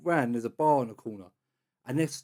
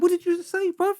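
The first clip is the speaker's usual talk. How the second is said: very high-pitched, incredulous challenge; acting out an indignant comeback; retold challenge. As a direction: spin round and demand it, pitch leaping up. Retold turning round to confront followers.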